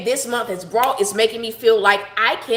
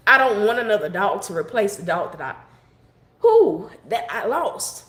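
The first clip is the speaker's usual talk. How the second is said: choked up. Real, not acted. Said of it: "This wasn't supposed to be a sad broadcast".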